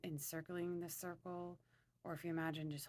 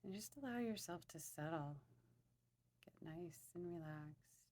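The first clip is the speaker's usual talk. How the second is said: guided meditation; 4 dB quieter than usual. Acted. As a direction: ease them softly into their breathing.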